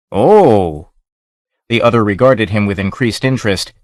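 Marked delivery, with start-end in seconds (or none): 0.1-0.9 surprised. A narrator's reading. Captain realizes who he is.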